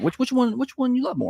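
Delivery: put-on high teasing kid voice; father mimicking the kids' teasing question, high voiced. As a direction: needle her like a pesky child. Parent voicing kids' teasing question.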